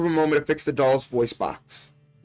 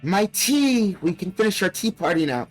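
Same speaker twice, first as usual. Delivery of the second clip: talking doll; 5 semitones higher than usual. Acted. Doll voice, excited.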